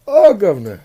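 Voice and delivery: mock British accent, pitched high; British accent, joking to friends. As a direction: ham up a Cockney-ish British.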